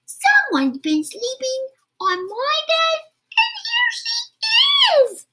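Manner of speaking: put-on very high, loud baby wail; small wailing voice. Baby Rhino wailing as he finds Goldilocks, very high. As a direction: squeal it out like a tiny excited child. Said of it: "wailed Baby Rhino in his small little voice".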